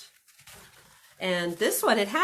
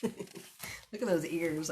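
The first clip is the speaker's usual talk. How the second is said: ordinary delivery, nothing marked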